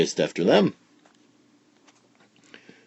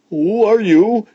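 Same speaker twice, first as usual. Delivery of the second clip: high, loud startled shout; sudden high alarmed question; startled cry; 12 semitones higher, 7 dB louder, slower than usual. A narrator's reading. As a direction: blurt it out in shock. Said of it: "he, he said".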